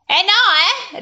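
high, loud, offended objection; miffed woman's protest; piqued retort. A narrator's reading. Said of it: "rispose piccata mia sorella".

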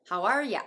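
"ya" for "you" in "How are ya"; reduced YOU. Shown as a demonstration. Teacher repeating the variant, "you" weakened to "ya" in "How are ya". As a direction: keep "are" clear, let "you" slacken to "ya". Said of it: "Uが音声変化して".

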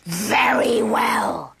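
slow, rough growling troll. Acted. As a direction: growl a grudging agreement. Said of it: "said the troll".

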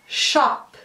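"shop" with an overdone, hard P; exaggerated final P. Shown as a demonstration. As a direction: pop the P at the end of "shop". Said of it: "eu tô exagerando". ("shop" com P final exagerado)

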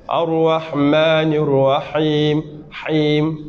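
careful, slow tajwid; slow recitation. Shown as a demonstration. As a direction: recite Ar-Rahman Ar-Rahim, Him slowly, giving each sound its full value. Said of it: "Vous devez sentir qu'ici".

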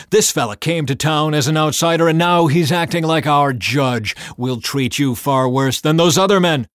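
loud, high angry yelling; angry shouting. A narrator's reading. The mob threatening Lot at the door, shouting angrily. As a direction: sneer and yell it at him. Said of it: "they shouted".

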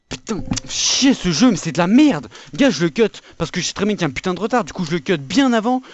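loud angry rant; raging gamer. Real, not acted. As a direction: vent it loud and fast. Raging at game lag.

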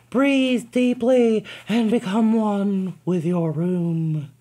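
slow, dreamy and high; soothing therapist. Acted. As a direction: ease her into a trance. Therapist guiding, slow soft.

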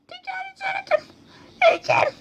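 high, soft, anguished cry; a dying figure moaning in pain; high, distressed voice. Acted. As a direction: moan it in a high, suffering voice.

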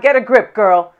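ordinary talk

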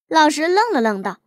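ordinary delivery, nothing marked

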